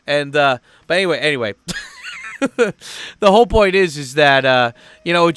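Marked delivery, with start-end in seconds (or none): 1.6-3.1 high-pitched giggle to himself; high laugh. Real, not acted. Vlogger moving on from his rant, laughing.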